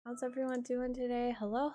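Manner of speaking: ordinary delivery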